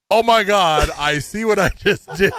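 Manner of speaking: mock sudden realization. Cashier realizes her slip, mock horrified. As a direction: raise the pitch, acting out her shock. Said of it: "And then she realized".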